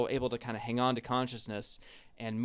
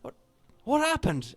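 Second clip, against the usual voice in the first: put-on higher, worried Cockney half-elf girl; frantic Cockney friend finding you wounded; Cockney accent; 11 semitones higher, 4 dB louder than usual. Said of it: "it's supposed to be Cockney".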